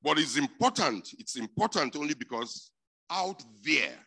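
loud, high preaching burst. Real, not acted. Preacher urging the congregation, loud and high. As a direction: preach it hard to the room. Preacher rising to full force.

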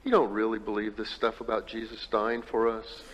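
loud preaching read of the quote. Acted. Pastor reading Adam Hamilton's words to the congregation, loudly. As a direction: read it out to the hall.

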